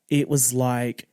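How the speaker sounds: normal talking voice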